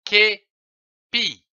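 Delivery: "képi" split into ké-pi; syllable by syllable. Shown as a demonstration. Teacher blending syllables for pupils, sounding out. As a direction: sound out "képi" in two beats. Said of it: "forme le mot".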